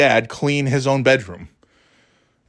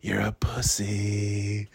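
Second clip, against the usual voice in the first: taunting; 4 semitones lower, 10 dB quieter, much slower than usual. Retold or imitated. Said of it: "He goes".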